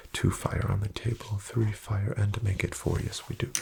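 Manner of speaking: normal talking voice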